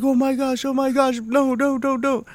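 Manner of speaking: high squealing mock panic; mock scared kids. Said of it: "they're like".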